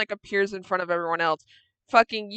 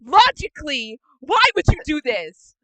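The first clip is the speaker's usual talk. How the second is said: loud, higher exasperated demand; acted yelling; 5 semitones higher, 5 dB louder than usual. Kira lecturing Hyden behind the door, loud exasperated yelling. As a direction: demand an explanation at volume. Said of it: "Absolute blood curdling, just yelling".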